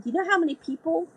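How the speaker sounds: a hint of a teasing old sailor; John's point. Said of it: "He said".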